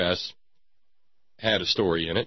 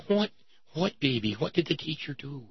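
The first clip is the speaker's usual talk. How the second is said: concerned parent; 5 semitones higher than usual. Acted. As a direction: gently coax the upset child, a touch hammed-up. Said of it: "they went to her and said".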